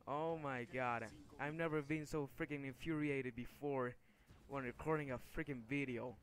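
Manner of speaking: usual speaking voice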